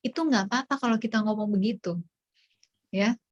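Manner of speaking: ordinary talk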